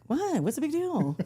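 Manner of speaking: high, innocent mock surprise; a hammed-up who, me; feigned innocence. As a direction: play dumb about the fuss.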